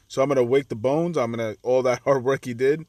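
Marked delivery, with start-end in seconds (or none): none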